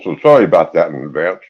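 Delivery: ordinary delivery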